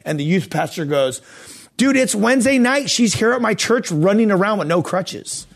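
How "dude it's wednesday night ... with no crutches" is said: high, loud, astonished outburst imitating a friend; amazed excitement. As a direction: burst out with thrilled disbelief. Youth pastor's amazed reply retold.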